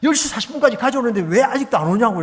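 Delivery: imitating an annoyed caller; retold angry complaint. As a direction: replay his irritated scolding, half-acted. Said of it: "막 화를 내면서".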